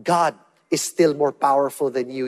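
ordinary delivery

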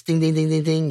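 rapid repeated game-show dings; imitated winner's bell. Host confirms contestant's right answer, bell imitation. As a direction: ring out a correct-answer bell by voice.

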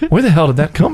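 usual speaking voice

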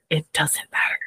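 unvoiced, whispered repeat; hushed insistence. Defending his looks to co-hosts, hushed insistence. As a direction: whisper it to drive the point home.

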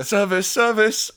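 high, loud, drawn-out call; hammed-up chef's shout, slow; mock kitchen call. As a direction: call out for service across a kitchen. Joins in as kitchen calling orders.

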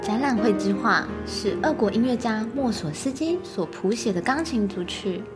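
usual speaking voice

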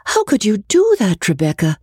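high, startled plea from a frightened schoolgirl; alarmed Amelia. A narrator's reading. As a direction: gasp it out in alarm, pitched high. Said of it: "She was exceedingly alarmed".

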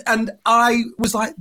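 usual speaking voice

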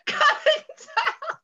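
high, helpless laughing fit; real laughter. Real, not acted. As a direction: laugh hard while trying to get it out. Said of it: "you could hear the screaming".

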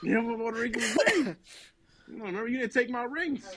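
high, hammed-up whimpering Jesus; mock wounded Jesus. Jesus scolding him at heaven's gates, hammed-up teary whine. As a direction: play a hurt Jesus, half crying for laughs. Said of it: "Jesus is like".

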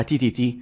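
تي تي تي with a sharp t; t sound. Shown as a demonstration. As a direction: say تي تي تي with a crisp voiceless t. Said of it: "في ناس هتسمعها". (نطق تي تي تي بحرف التاء)

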